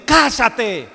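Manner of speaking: drawn-out, commanding mock rebuke; acting out a forceful order to be silent; stern rebuke. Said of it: "Y le reprendió diciendo". (reprensión firme, imitada)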